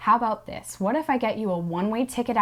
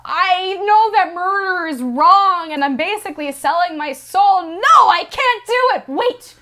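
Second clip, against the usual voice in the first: higher and louder, agonising; anguished Seymour; 8 semitones higher, 6 dB louder than usual. Retold or imitated. Seymour torn in his dream, rising anguish. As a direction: torn over his conscience.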